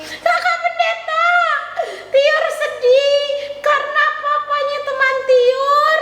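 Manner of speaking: high puppet voice, mock-sad; childlike puppet, lightly sad; puppet girl Tiur. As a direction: tell the sad news as the little puppet. Puppet Tiur explains sadness.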